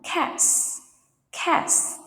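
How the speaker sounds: "cats" ending in a crisp unvoiced S. Shown as a demonstration. Teacher models plurals for students, S ending on "cats". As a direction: say "cats" with a clear hissed S at the end. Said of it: "S sound pronounce".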